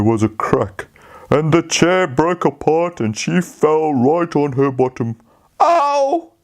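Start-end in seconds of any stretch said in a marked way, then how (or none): 5.6-6.3 high, mock little-girl yelp; a small pretend ouch, pitched up; little girl's ow. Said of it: "she cried".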